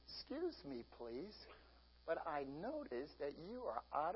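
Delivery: soft, polite mock-sweet request; sweetly asking the clerk about mayonnaise; kindly asking. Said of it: "you go up kindly".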